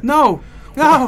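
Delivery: high, loud alarmed no; alarmed shout. Real, not acted. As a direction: cry out in sudden alarm. Panicked at opening MS Word.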